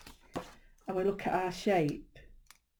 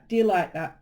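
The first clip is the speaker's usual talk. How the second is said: ordinary talk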